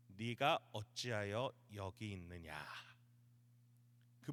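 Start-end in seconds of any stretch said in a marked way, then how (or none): 0.1-2.7 low, slow, grave question; God speaking. Acted. God questions Elijah at the mountain, low slow solemn voice. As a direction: ask it slowly in a lowered voice. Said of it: "여기에서 말씀하시는 게 이 말씀이신 거예요".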